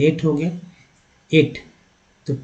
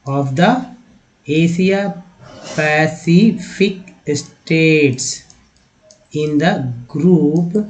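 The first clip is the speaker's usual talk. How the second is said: very slow, word by word; slow reading drill; 3 dB louder, much slower than usual. Shown as a demonstration. Teacher reading slowly for learners, drawn out. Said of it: "reading drill, word by word".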